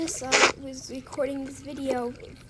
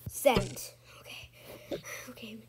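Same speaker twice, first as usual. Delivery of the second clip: whispering; 4 semitones lower, 5 dB quieter, slower than usual. Acted. Secretly sending video.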